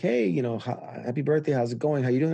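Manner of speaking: bright, upbeat phone greeting; chirpy friendly check-in; cheery caller. Retold or imitated. Lightly voiced upbeat charity caller wishing happy birthday. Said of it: "they're like".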